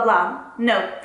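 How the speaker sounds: ordinary delivery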